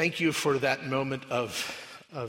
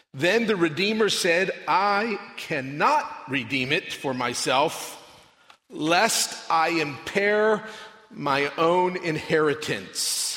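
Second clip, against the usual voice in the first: loud, raised, declamatory Bible reading; proclaimed scripture reading; 4 semitones higher, 8 dB louder than usual. Real, not acted. Preacher reads the book of Ruth to the congregation, proclaiming. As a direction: proclaim it to the whole room, phrase by phrase with weighty pauses.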